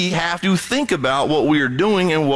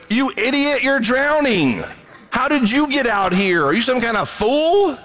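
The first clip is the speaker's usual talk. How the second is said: high, laughing mockery of a drowning man; mocking the drowning; 8 semitones higher than usual. Preacher jeering at a drowning swimmer, high laughing mockery. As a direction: jeer at him with a laugh in the voice. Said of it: "you don't mock the drowning person".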